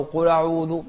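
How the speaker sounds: loud "قل أعوذ" run together as qula'udhu; blurred hamza. Shown as a demonstration. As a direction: slur over the hamza in "قل أعوذ", quickly. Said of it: "ما يوضح الهمزة". (إخفاء الهمزة خطأً في "قل أعوذ")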